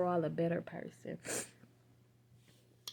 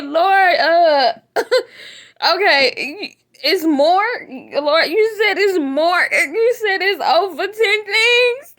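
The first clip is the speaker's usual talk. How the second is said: high, loud, slow laughing cry; laughing through tears; 10 semitones higher, 17 dB louder, much slower than usual. Real, not acted. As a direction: plead to God half laughing, half crying. Laughing-crying plea to God.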